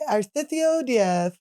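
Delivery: slow Spain-Spanish accent with lisped th sounds; Castilian Spanish lisp. Shown as a demonstration. Host shows how to speak in Spain, slow lisped Castilian accent. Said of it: "You have to do the lisp".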